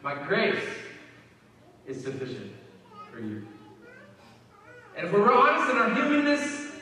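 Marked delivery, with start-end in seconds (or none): 0.0-3.5 God's gentle answer. Pretend. God's answer, slow and quiet.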